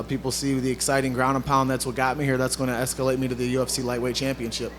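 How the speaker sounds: ordinary delivery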